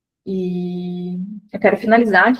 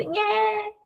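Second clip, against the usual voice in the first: put-on baby whimper; 11 semitones higher, 5 dB quieter than usual. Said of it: "e começa a chorar".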